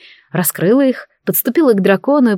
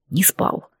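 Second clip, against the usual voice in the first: quiet; 8 dB quieter than usual. A narrator's reading. Tired dragon answers softly.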